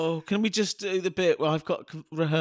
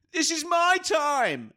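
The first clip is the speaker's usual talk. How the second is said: high, slow grand proclamation; hammed-up triumph; triumphant declaration; 12 semitones higher, 3 dB louder, much slower than usual. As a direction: announce it like a hero. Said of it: "And I went".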